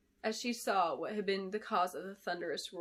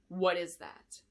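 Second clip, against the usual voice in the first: hissing; 2 semitones lower, slower than usual. A narrator's reading. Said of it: "hissed Clarice".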